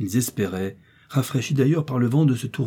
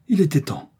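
higher-pitched exclamation of relief; raised, emphatic cry; exclaiming; 6 semitones higher, 4 dB louder than usual. A narrator's reading. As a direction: exclaim it as a narrow escape. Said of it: "s'écria le docteur".

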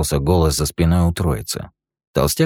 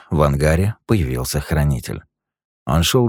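ordinary delivery, nothing marked

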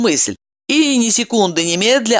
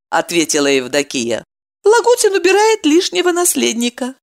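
higher woman voice, firm; woman's voice; 9 semitones higher than usual. A narrator's reading. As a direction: tell it plainly as her. Evdokia voiced, narrator tags between.

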